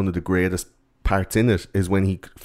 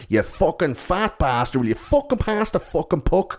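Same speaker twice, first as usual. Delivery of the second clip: mock kid yelling; 9 semitones higher than usual. Kid on the ice at the goalie, lightly hammed kid rant. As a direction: badger a teammate like a bratty kid.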